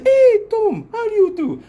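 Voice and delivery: put-on very high, loud African-accented greeting; hammed-up high-pitched hello in African accent. African braiders greeting him at the shop, African accent. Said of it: "So the Africans, they would look, they say".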